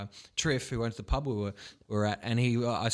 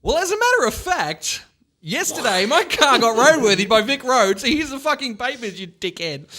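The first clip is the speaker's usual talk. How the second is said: much higher, louder mock indignation at the cop; indignant retort; 12 semitones higher, 7 dB louder than usual. Retelling his cheeky retort to the cop, high indignant. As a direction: fire it back at him defiantly.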